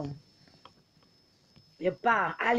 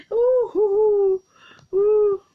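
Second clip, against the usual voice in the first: loud high hooting cheer, in real glee; loud high hoots; 10 semitones higher, 7 dB louder, much slower than usual. Real, not acted. Cheering her live viewers with loud high hoots. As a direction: hoot at the viewers like a crowd cheering.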